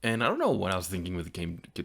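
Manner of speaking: ordinary delivery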